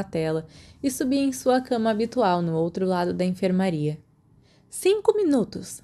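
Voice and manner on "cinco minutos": much higher, curt snap of a strict nurse; sharp retort. A narrator's reading. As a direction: cut the visit short, sharply. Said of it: "Madame Pomfrey retrucou". (Madame Pomfrey retrucando, seca e bem mais aguda)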